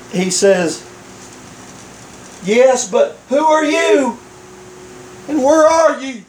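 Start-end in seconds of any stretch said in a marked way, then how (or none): 2.4-6.2 higher-pitched acted call; calling out questions. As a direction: call up to an unseen stranger. Stranded man questions unseen voice.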